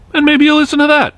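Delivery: sarcastic high-pitched aside; sarcastic jab. Acted. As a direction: pitch jumps way up on maybe, dry and pointed. Pointed sarcastic punchline.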